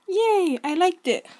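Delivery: high, loud little victory squeal; excited cheer. Real, not acted. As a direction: celebrate the click like a small win. Pleased after clicking like.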